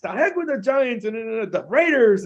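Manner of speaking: high, loud imitation of a raving fan; yelling. Friend imitating Mo yelling over the phone, high loud shout. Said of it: "he was like".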